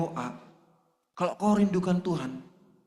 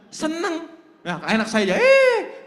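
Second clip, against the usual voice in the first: laughing; 9 semitones higher, 7 dB louder than usual. Real, not acted. Laughs at own example.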